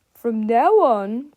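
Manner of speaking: slow, loud menacing growl; Mister Krupp. A narrator's reading. Krupp begins the detention orders, growling. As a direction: drag out the order. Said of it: "growled Mister Krupp".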